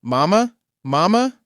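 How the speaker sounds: high, loud crying call for mama; crying child calling. A narrator's reading. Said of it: "the child's crying get louder, still calling out".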